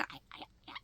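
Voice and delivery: whispered tiny squeaking mimic; bat squeak. Describing the bat on the towel, whispered squeak imitation. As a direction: imitate a little bat chirping.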